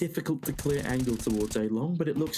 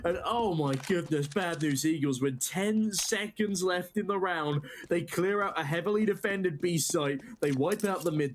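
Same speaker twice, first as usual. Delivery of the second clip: esports caster; 6 semitones higher than usual. Acted. Live play-by-play.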